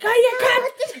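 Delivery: laughing mock protest. Quoting herself in the fight, laughing.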